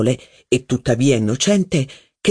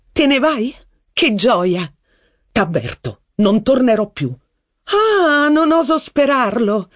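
high, mocking jeer; taunting Rachel; 9 semitones higher than usual. A narrator's reading. Rachel mocks Saint Loup's threat to leave, high and scornful. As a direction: needle him in a high scornful voice.

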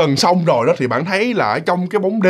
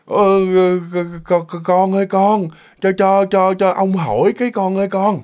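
put-on weak, halting old man's voice; feeble old man. Old ghostly man stops a boy to ask something, weak and halting. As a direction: stammer slowly, like a frail elder. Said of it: "cái giọng nó rất là yếu ớt".

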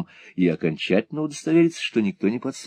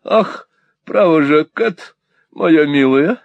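high, fluttery protest; weak voice; 9 semitones higher, 6 dB louder, slower than usual. A narrator's reading. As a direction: say it as if overcome. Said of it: "сказала миссис Никльби слабым голосом".

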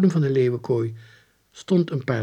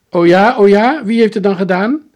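loud, high angry challenge; father's full-voiced furious retort; raging; 10 semitones higher, 13 dB louder than usual. A narrator's reading. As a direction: rage back at your wife. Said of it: "raasde Jaapie's vader".

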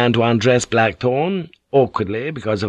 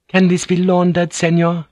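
a higher, courteous woman's voice; polite lady; 9 semitones higher, 4 dB louder than usual. Acted. Mariko asking the boatswain about laundry, higher polite voice. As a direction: ask it gently and properly, a higher lady's voice.

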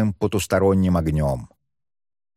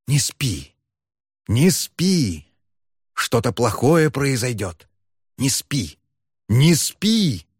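many voices calling; 3 semitones higher, slower than usual. A narrator's reading. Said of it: "Голоса на всевозможные лады".

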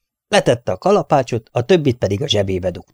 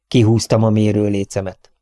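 ordinary delivery

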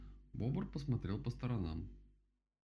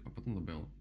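ordinary delivery

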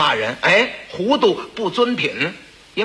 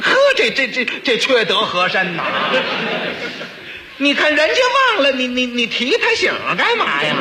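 panicked officials; 6 semitones higher than usual. Acted. Officials panic, blame Heshen.

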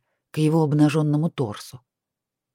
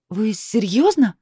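indignant; 5 semitones higher, slower than usual. A narrator's reading. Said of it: "не пыталась скрыть своего негодования".

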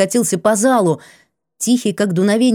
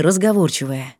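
plain reading voice

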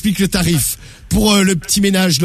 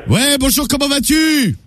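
raised, higher voice for a deaf man; to someone hard of hearing; 8 semitones higher than usual. Acted. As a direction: speak up so he hears you. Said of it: "je vais pas me péter une corde vocale".

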